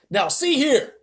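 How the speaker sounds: very high, loud call; police chief calling up, stern shout. Acted. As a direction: shout it through cupped hands. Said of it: "shouted the chief of police".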